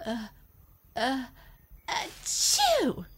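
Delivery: rising ah ah then a loud high achoo; building sneeze. Acted. Elephant sneezing at his friends, rising then bursting.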